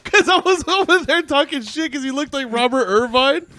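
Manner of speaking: high, laughing through the words; can barely get it out for laughing; giggling story of forgetting a name. Real, not acted. Laughing while retelling the gaffe.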